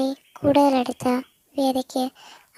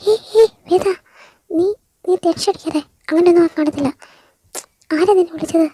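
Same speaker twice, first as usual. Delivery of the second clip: urgent comforting; 4 semitones higher, 6 dB louder than usual. Acted. Stella calming panicked Veda.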